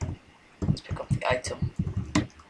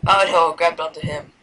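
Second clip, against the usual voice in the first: ordinary talk, nothing marked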